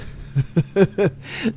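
put-on a high groaning old man's laugh. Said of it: "Wiederum lachte er ächzend". (ächzendes Lachen eines alten Mannes)

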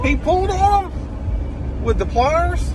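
high mock shock. Parent mock outrage, high pitched.

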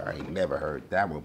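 ordinary delivery

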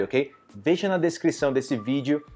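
normal talking voice

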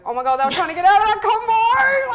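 high, loud mock outburst; mock exclamation. Mocking people who slip away from a conversation, exclaiming loudly. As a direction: exclaim it in a big shrill mock voice. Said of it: "I go".

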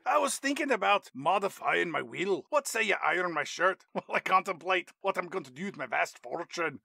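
mock Scottish father dangling a bribe; Billy Connolly. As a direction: coax in a put-on Scottish accent. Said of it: "Billy Connolly hands Paul Walker".

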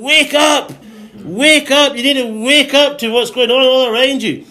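insistent high-pitched exhortation; urgent wake-up call. Acted. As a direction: rouse a sleeping church. Voices God rousing the church.

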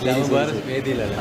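soft, slow, barely audible reply; mumbled away from the microphone; too quiet for the mic. Real, not acted. Teacher answers off-mic, soft and slow. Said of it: "മൈക്കിൽ പറഞ്ഞു".

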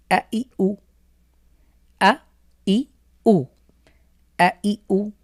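teacher sounding out a, i, u for students; Arabic vowel drill. Shown as a demonstration. Teacher drilling Arabic vowels for beginners, short and crisp. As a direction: model each vowel crisply, no stretching. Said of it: "এই যে আমি টান ছাড়া দ্রুত পড়ছি".

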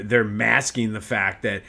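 normal talking voice

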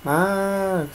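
high called-out name through the door; someone calling out. Retold or imitated. Voice outside the door calling for Max at dawn, high call. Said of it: "escucho que están gritando".

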